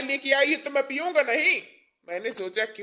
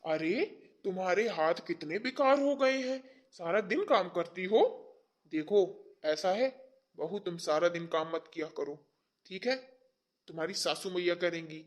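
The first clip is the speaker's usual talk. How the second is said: soft, low coaxing voice; tender concern; 3 semitones lower, 6 dB quieter than usual. Acted. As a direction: fuss gently over the daughter-in-law. Father-in-law fusses over daughter-in-law.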